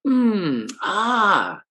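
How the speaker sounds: high, encouraging mm and ah sounds; encouraging hums. Shown as a demonstration. Dog student listening, encouraging the speaker with hums. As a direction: hum along warmly to encourage. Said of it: "They will make sounds that are encouraging".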